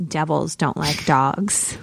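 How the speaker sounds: low, slow deadpan; deadpan quip. Retold or imitated. Joking to the checkout guy about a rude customer, deadpan. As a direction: drop it slowly, like a dry verdict.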